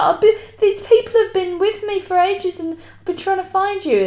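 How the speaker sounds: high, loud put-on girl's complaint; imitating drunk girl. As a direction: whine loudly in mock drunken protest. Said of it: "she was drunk and annoying".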